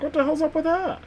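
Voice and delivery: mock astonishment. Streamer retelling reaction to a Scottish-sounding actor, mock astonished.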